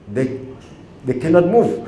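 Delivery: ordinary talk, nothing marked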